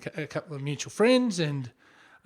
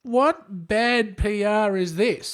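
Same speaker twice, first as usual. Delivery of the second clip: slow dismay; 4 semitones higher, much slower than usual. Retold or imitated. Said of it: "I'm just thinking".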